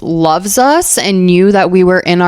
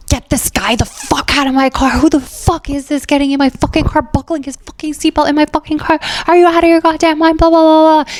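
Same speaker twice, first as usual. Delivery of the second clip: high-pitched imitation of a friend flipping out; pitched-up rant, no real volume; mock screaming; 8 semitones higher, faster than usual. Friend yelling about the guy in his car, mock screaming. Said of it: "He's, like, screaming".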